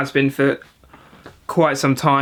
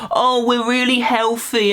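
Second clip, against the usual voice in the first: higher, slower smug mimicry; mocking cyclist; 8 semitones higher than usual. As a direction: sneer at a self-righteous cyclist. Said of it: "they kinda get away with it".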